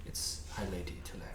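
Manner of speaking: hushed, quick admonishing whisper; parent whisper. Acted. Grandmother leaning in to correct you beside the stable master, whisper. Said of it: "that parent whisper in your ear".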